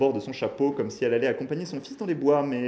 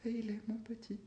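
high, soft, plaintive call; poignant; 12 semitones higher, 10 dB quieter than usual. A narrator's reading. Grandmother to her departing son, poignant call. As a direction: call after him tenderly, high and faint. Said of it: "d'une voix poignante".

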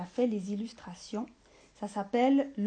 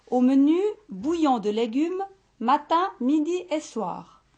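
louder, higher pompous doctor; doctor prescribing; 4 semitones higher, 6 dB louder than usual. Acted. Doctor prescribing broth to the ogre, loud and pompous. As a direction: announce the dull menu firmly.

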